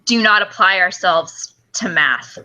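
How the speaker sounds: ordinary talk, nothing marked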